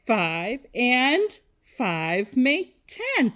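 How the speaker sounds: slow, word-by-word recital; leading choral repetition. Shown as a demonstration. Teacher leading kindergartners to say it with her, slow choral pace. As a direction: space out each word so all can join. Leads class in saying it together.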